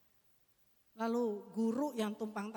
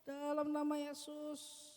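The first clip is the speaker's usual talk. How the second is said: demo prayer; 4 semitones higher, slower than usual. Shown as a demonstration. Demo prayer over child.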